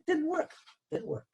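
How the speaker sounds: jumps way up in pitch, a fed-up sing-song; exasperated. Retold or imitated. Customer watching the transfer fail, exasperated and high. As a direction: frustration leaking out as the transfer fails again. Repeated failed transfer attempts.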